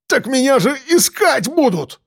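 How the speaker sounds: high-pitched, desperate howl; shrill-pitched, frightened protest; panicked wail. A narrator's reading. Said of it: "взвыл Андрей".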